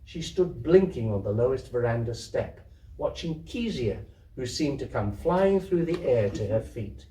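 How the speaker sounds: plain reading voice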